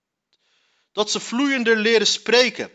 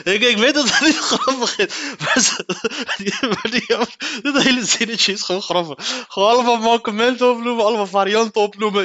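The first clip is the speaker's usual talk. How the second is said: higher, breaking into laughter; niet kunnen stoppen met lachen; 4 semitones higher, 3 dB louder than usual. Real, not acted. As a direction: admit it through helpless giggles. Cannot stop laughing while apologising. (hikkend van het lachen)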